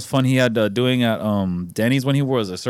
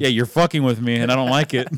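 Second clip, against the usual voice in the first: ordinary talk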